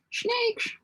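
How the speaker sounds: squeaky high hissing snakes; silly high voice. Pretend. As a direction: squeal it out like a cartoon. Reads a chat word in a silly voice.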